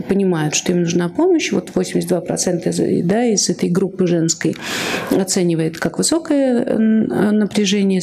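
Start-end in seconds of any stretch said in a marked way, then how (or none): none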